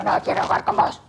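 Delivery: put-on a shy little girl whispering her refusal; timid whisper. Little girl timidly refusing the boy, whispered. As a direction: barely breathe the words out, timid. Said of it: "la nena le dijo".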